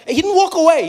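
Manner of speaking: normal talking voice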